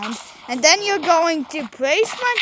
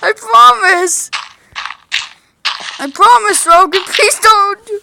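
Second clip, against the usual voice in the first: louder desperate begging; loud pleading; 5 semitones higher, 7 dB louder than usual. Real, not acted. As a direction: plead loudly with a friend. Pleading not to be attacked.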